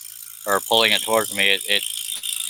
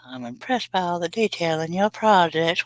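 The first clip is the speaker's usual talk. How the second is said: reads the comment in a high falsetto voice; a mock lady's voice for the viewer; high put-on voice; 9 semitones higher than usual.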